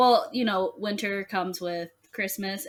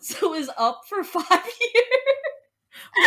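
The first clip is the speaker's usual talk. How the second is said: laughing; 12 semitones higher, 5 dB louder, slower than usual. Real, not acted. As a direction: pitch climbing into laughter. Laughing at own family story.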